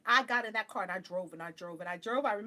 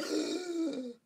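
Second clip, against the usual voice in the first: long, high intake of breath; amazed gasp; 8 semitones higher than usual. Retold or imitated. Seeing the Chapel of the Holy Cross, amazed gasp. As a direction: gasp in re-enacted astonishment.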